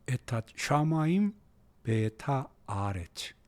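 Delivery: measured, softly spaced-out recitation; slow demonstration reading. Shown as a demonstration. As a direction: read slowly, drawing out each word. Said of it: "이렇게 읽어버릇 하셔야 돼요".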